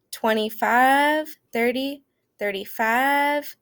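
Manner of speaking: fives stretched long in a Southern drawl, slow; hammed-up Southern "five" drawled; dragged-out Southern fives. Class counting by fives in exaggerated Southern drawl. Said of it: "we'd drag it out".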